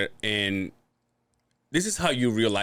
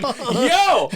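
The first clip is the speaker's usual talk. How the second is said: shocked yo; 12 semitones higher, 6 dB louder than usual. Retold or imitated. Shocked exclamation retold.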